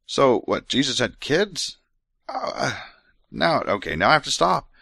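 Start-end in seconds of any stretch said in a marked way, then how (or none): 0.7-1.8 incredulous question. Real, not acted. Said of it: "What in the world?".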